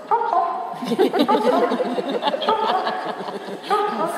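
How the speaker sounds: high, bright chirps to hurry the horse; urging chop chop. Real, not acted. Trainer urging the lunging horse on, high-pitched. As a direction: cluck the horse along in a high voice.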